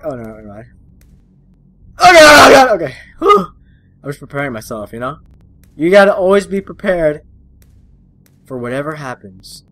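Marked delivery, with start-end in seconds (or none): 2.0-3.5 startled gasping oh God; shaken, catching breath; real scared gasp; real fright. Real, not acted. Startled in horror game.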